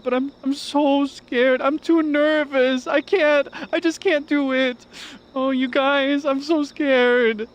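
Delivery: very high, loud, drawn-out whine; Wilson's caricature of me. Acted. Mocking a friend's impression of oneself, squealing scared whine. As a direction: wail it like a hammed-up scaredy-cat. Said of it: "my impression of Wilson's impression of me".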